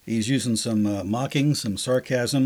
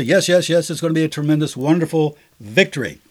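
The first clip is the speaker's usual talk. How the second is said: higher, emphatic yes-men chorus; gushing assurance; 4 semitones higher, 5 dB louder than usual. Retold or imitated. False prophets all answering the king, gushing assurance. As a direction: eagerly promise a sure win, playing flatterers.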